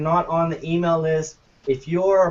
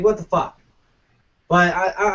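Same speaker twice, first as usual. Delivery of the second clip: ordinary talk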